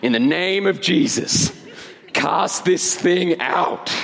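mock televangelist, slow drawn-out command; televangelist prayer. Preacher praying to cast the thing out, hammed-up televangelist. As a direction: stretch each word like a TV preacher casting out evil. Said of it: "like one of those televangelist prayers".

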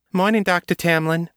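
put-on chirpy front-desk greeting in a South Side accent; thick South Side accent. Receptionist greets the doctor, light South Side accent. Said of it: "thick, nasal South Side accent".

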